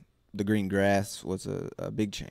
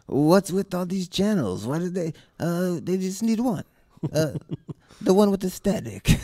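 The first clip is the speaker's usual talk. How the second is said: comedic foreign voice; 9 semitones higher, 4 dB louder than usual. Retold or imitated. Continues voicing the troupe.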